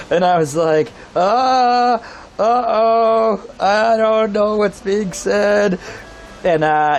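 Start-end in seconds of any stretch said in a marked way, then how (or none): 1.1-5.8 slow, higher, drawn-out mock confusion; baffled. Visitor to incomprehensible drunk, slow mock bafflement. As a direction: stretch each sound in helpless puzzlement.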